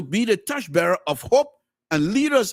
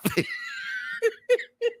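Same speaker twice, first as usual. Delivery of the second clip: big laugh; 5 dB quieter than usual. Real, not acted. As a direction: let the laugh break out. Host bursts out laughing on air.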